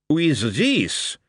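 high, slow, disdainful. Acted. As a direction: ask like a society lady finding something in her soup. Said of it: "in a tone a society lady might take".

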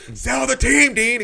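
high, full-volume mock heckle; imitated heckler's shout. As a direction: yell it across a crowd. Retelling a heckler calling to Dean.

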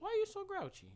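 high, soft voice for the dog; pet talk. Real, not acted. To the growling dog, high, gentle pet talk. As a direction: coo at the grumpy dog.

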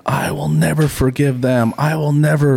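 a half-strength replay of the scream; retold screaming. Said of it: "they start screaming".

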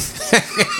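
high, giggling burst; laugh. Real, not acted. Laughing about the last podcast. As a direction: laughs at the memory.

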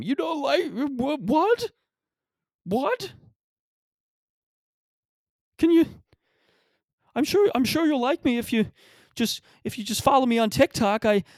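very high, panicky put-on voice; mock anxiety attack. Anxious TikTok kid pleading with a pub tough, mock panicky. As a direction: squeak out a kid's mock panic, stammering. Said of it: "they'd get, like, an anxiety attack".

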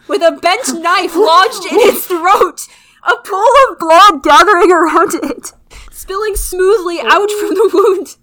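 milking the gory line with mock horror; hammed-up gore reading. Friend reading a gory story aloud, hammed-up mock horror.

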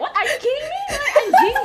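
high, loud, appalled squeal; shocked disbelief. Retold or imitated. She recalls her own reaction to degrading talk, loud and high and shocked. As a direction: recoil in disbelief, pitch jumping high. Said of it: "I'm like".

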